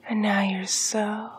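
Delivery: usual speaking voice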